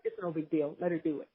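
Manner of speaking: low, soft, indifferent shrug; quiet husband. Retold or imitated. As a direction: mumble it like the passive husband.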